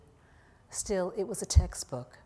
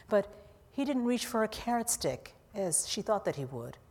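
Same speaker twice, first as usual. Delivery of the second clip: usual speaking voice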